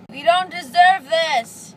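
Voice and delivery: slow loud indignant cry; drawn-out drugged outburst; loud protest. Real, not acted. Drugged teen protesting prices.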